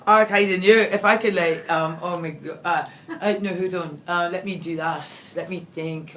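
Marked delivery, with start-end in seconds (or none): 0.1-1.3 loud, booming Scottish attempt; Scottish accent. Shown as a demonstration. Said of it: "do your best Scottish accent".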